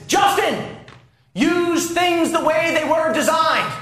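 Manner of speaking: high, drawn-out mock scolding; imitated stern father. Father chastising his son for misusing things, sternly imitated. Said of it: "he would sternly say".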